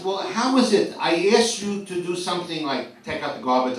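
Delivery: loud, high mock protest; exasperated parent. Parent to forgetful kids, mock exasperation. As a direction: protest it loudly, playing up the disbelief.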